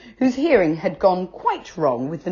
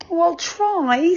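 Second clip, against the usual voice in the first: put-on very high, slow, dejected boy; a sorry boy promising weakly; Joe, high and meek; 13 semitones higher, slower than usual. A narrator's reading. Poor Joe.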